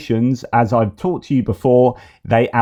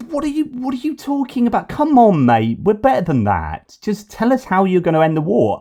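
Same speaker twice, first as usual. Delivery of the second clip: exasperated; 9 semitones higher than usual. Real, not acted. Exasperated reaction to the candidate.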